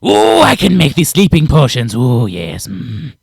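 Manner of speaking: loud gleeful creature voice, settling down; eager lizard man. Acted. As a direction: burst out delighted, then sink to a hum. Lizard man answers the yelling prisoner.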